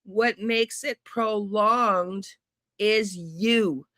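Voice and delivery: "What makes it prolonged" slow, each word leaned on, "you" hit hard; pointed retort. Real, not acted. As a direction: spell it out slowly, then stab the word "you". Retorting to the sheriff's clip.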